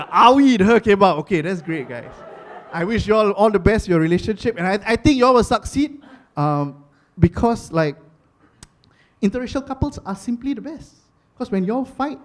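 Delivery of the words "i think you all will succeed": usual speaking voice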